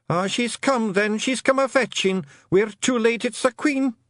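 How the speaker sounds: high Scottish wail of doom; little clansman in despair; panicked Feegle. Acted. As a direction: lament it in a high, stretched voice. Feegle wails that Queen has come.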